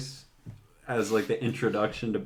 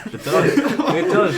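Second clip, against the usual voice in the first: laughing; 10 semitones higher, 8 dB louder, slower than usual. Real, not acted. Laughing agreement about terrible cast.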